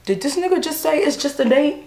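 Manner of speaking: high-pitched disbelief rising at the end; incredulous. Real, not acted. Woman stunned by her date's dismissal, incredulous. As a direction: repeat it back as an outraged question. Incredulous reaction to his remark.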